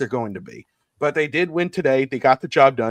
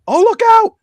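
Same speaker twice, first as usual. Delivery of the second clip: sudden high yell, much louder; retold shout; 7 dB louder than usual. Fan yelling at the TV during the big play, high excited shout. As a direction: shriek a warning at the TV. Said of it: "all of a sudden I go".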